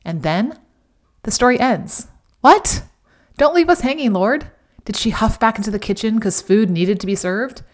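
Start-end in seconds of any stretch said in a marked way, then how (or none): 2.4-2.8 high, loud disbelief; shocked what. Real, not acted. Narrator stunned that the Bible story stops there, shocked. 3.4-4.5 mock protest. Real, not acted. Protesting the story's abrupt end.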